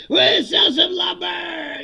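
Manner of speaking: mock revival yell, high and very loud; hyped convention shout. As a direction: holler lumber sales like a rally. Mimicking Home Depot rally.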